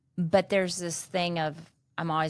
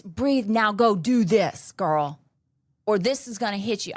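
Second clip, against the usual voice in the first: urgent push; 4 semitones higher, 5 dB louder than usual. Retold or imitated. Friend urging her to act.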